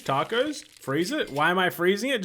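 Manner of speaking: normal talking voice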